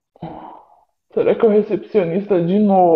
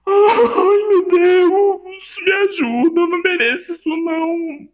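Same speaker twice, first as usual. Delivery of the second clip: much higher, whiny wail; 12 semitones higher than usual. Acted. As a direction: wail in mock despair. Desespero choroso com a aparência. (lamentando choroso e agudo)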